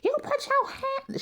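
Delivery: high, slow shrieking accusation; mock accusation. Tamar accusing Trina, mimicked very high and slow. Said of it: "Because Tamar".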